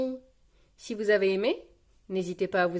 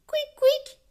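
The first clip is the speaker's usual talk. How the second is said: very high, louder little squeak; two sharp high-pitched squeaks; squeaky squeal; 6 dB louder than usual. Acted. Richard with his toy back, squeaking high.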